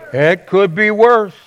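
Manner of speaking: slow, stressing "worse"; with great passion. Shown as a demonstration. Pastor tells people what to say at their front door, slow and passionate. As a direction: savour every word and land on "worse". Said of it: "say with great passion".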